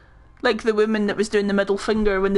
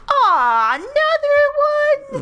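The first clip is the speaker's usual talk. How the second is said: high, loud, drawn-out mock outcry; exasperated woman imitation; 6 dB louder, much slower than usual. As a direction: wail it as a mock-appalled voter. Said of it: "the woman that goes".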